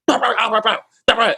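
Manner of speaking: high, loud babble of a cop shouting; mock cop yelling. Imitating the veteran cop shouting at the car, mock yell. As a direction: yell nonsense like a cop losing it. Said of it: "Why are you yelling at us?".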